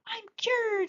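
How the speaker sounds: very high excited cry; joyful shout. Acted. Girl celebrating her cure, excited shout. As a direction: burst out with joy. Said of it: "she shouted".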